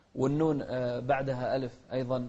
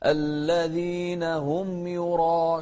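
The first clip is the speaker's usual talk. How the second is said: slow, sung recitation; slow sung Quran recitation; melodic Quran recitation; 2 semitones higher, 5 dB louder, much slower than usual. Shown as a demonstration. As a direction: chant it slowly and melodically.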